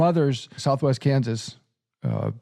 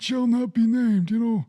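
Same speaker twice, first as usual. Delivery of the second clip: mock ominous; 8 semitones higher than usual. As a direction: play it like a forbidden secret. Joking about the mob.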